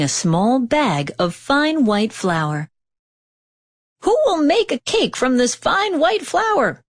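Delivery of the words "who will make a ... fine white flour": high, eager hen's question; hen crying out. A narrator's reading. Hen asking the lazy animals for help, high call. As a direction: call out brightly as the little hen. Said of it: "asked the little red hen".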